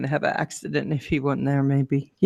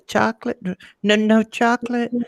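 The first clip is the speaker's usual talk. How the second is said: mimicking the customer; 6 semitones higher than usual. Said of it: "oh yeah".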